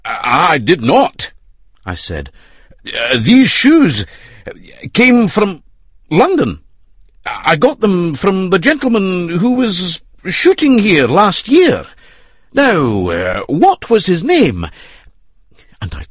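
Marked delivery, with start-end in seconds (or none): 0.0-1.3 rustic roadman voice. Acted. Hannay playing dumb roadman. 2.9-14.7 rustic roadman voice. Acted. Hannay disguised as roadman.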